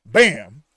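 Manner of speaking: shouted gunshot sound effect; loud mock gunshot; loud gunshot bang. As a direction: bark out the shot like a gun. Acting out the gunshot.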